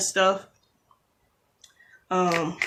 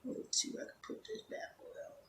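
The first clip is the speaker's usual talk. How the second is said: whispered concentration; 19 dB quieter than usual. Real, not acted. Concentrating on placing nail.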